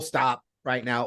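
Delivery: low, slow firm command; serious low-voiced order; actual stop. Shown as a demonstration. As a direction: drop the pitch and take it slowly, meaning it.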